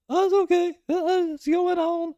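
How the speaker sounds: mock falsetto, far above own voice; silly squeaky impersonation; [name]. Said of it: "Why does he sound like".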